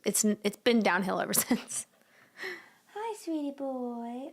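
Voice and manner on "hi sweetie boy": high, slow, soft coo to the cat; pet talk. Real, not acted. Cooing to the cat.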